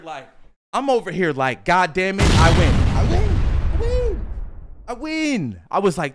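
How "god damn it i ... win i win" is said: acted triumphant yell. Telling how he reacted to Mom's tears, triumphant yell. Acts out own celebration of winning.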